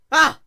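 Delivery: high startled shriek; shocked scream. A narrator's reading. Princess shrieks in shock at the war in her mane. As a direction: yelp in alarm.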